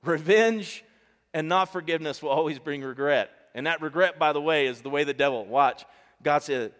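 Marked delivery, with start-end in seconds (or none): 0.1-3.3 repeated louder. Shown as a demonstration. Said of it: "Revenge, not forgiveness".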